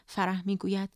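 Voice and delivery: usual speaking voice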